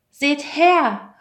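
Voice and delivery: high, loud call for attention. A narrator's reading. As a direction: call out to everyone before you. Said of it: "rief er".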